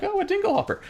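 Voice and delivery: very high, naive wonder; mock a wide-eyed innocent marvelling at an object; Little Mermaid. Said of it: "almost like the Little Mermaid".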